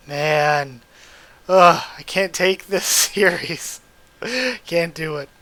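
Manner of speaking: loud, drawn-out groaning protest with a laugh; overwhelmed exasperation. Real, not acted. Overwhelmed reader reacts to sad series.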